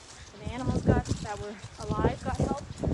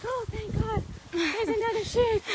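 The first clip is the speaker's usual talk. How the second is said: high, relieved little sheep voice; 9 semitones higher, 5 dB louder than usual. Retold or imitated. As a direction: voice the sheep as if it is saved. Voicing the new sheep Betty.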